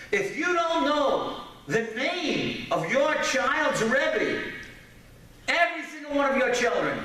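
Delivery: high-pitched, insistent sermon delivery; emphatic preaching. Real, not acted. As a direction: press each point on the congregation. Preaches to fathers and mothers.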